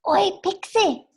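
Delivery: high-pitched, urgent hail; frantic call. Acted. Ginger calls the adjacent cell for attention, frantic high call. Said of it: "is frantically trying to say".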